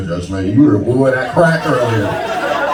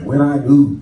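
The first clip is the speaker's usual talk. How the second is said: mock profound; 5 semitones higher than usual. Comic telling the crowd his mock-profound coming-out answer. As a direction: pause as if recalling something profound. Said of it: "I was like".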